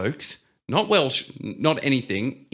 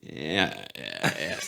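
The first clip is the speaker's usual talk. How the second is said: low, soft, hesitant yeses; bluffing agreement; 9 semitones lower, 8 dB quieter, much slower than usual. Retold or imitated. Baffled Australian nodding along to English blokes, low unsure agreement. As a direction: trail down as if pretending to understand. Acted giving up on understanding them.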